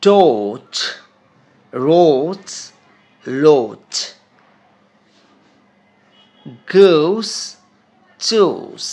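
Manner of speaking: each word said loud and apart; teacher models sounds. Shown as a demonstration. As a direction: drill ডোচ, রোচ, লোচ, গোস, চুস. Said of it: "এরপর উচ্চারণটা খেয়াল করো".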